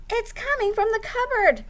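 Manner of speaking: owl working out where the noise is; lightly voiced owl. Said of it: "said Owl".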